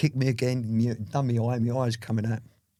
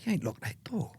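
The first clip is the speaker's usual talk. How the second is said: whispered aside to himself; 6 semitones higher, 6 dB quieter than usual. Retold or imitated. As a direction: notice the unlocked door in a whisper. Retold whispered realisation.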